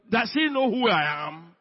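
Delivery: loud, high affronted big man; indignant shout. Acted. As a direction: act outraged pride at full volume. Acting out the offended Naaman.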